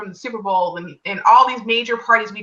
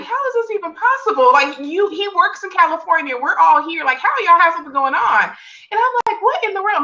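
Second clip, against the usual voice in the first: a much higher, stunned retelling of her own disbelief; 7 semitones higher than usual. Retold or imitated. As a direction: replay the shock at the betrayal.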